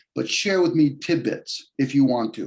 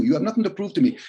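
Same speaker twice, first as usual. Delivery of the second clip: ordinary talk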